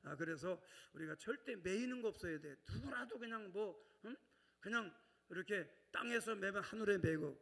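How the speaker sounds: ordinary talk, nothing marked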